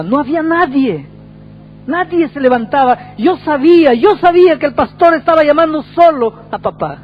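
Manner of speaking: high, loud, anguished retelling; impassioned acted wail of a grieving son; acted tearful outcry. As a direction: cry out as the distraught son, voice pitched far up. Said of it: "y llorando me dice".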